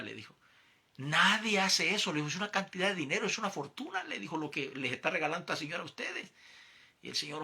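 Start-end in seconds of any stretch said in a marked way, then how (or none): 1.0-6.3 high, emphatic disbelief; amazed rebuke. Retold or imitated. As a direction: lawyer telling his friend this is a fortune, high and amazed. Lawyer rebukes his friend, amazed.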